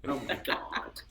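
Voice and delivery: whispered disbelief. Real, not acted.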